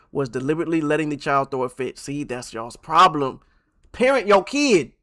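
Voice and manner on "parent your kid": stern scolding. Real, not acted. Scolding the absent mother.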